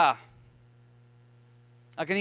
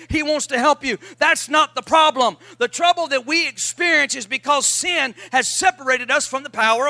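loud, rising preacher's call; loud preacher building to a shout; full-force preaching; 4 semitones higher, 5 dB louder than usual. Real, not acted. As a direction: preach it out to the back row. Preaching climax.

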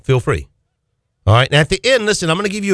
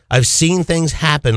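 ordinary talk

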